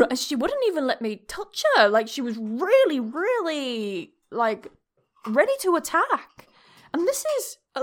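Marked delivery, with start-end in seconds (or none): none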